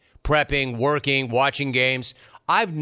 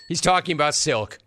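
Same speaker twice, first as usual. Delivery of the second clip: ordinary talk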